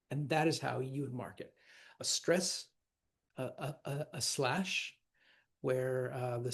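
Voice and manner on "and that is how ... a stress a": normal talking voice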